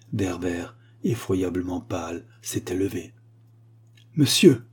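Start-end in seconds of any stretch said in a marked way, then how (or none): 4.2-4.6 sharp loud cry of Monsieur; exclaiming. Acted. Teacher Werber cries out at the pupil, loud and sharp. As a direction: burst out at the pupil. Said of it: "s'écria-t-il".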